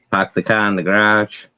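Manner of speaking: "Park the car in the garage" with no r's, pahk the cah; Boston accent. Shown as a demonstration. Reciting the famous Boston phrase for a friend. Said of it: "that's a famous one right there".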